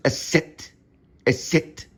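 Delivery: "eset" as the name of the sharp S; crisp demo of "eset"; German letter name. Shown as a demonstration. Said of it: "It's called the eset".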